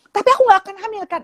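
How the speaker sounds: high, quick imitated kid; worried child. Child asking mother for reassurance, high and quick. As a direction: blurt it like an anxious child.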